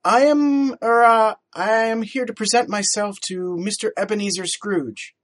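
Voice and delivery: put-on young lad blurting high and loud, stumbling on er, uh; a startled boy rushing out his errand; young Fred announces himself to the two men; nervous boy. A narrator's reading. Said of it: "Fred spoke furtively".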